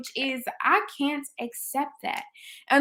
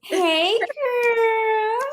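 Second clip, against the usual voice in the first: very high, stretched-out squeal; excited greeting; 12 semitones higher, 4 dB louder than usual. Real, not acted. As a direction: squeal hello to a dear friend. Host excitedly greets her guest.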